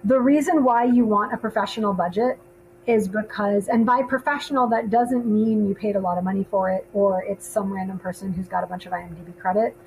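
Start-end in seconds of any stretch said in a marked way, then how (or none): none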